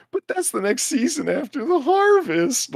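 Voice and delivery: high-pitched whiny protest; Luke Skywalker whine. Acted. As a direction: complain like a thwarted farm boy. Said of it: "everything he says is so whiny".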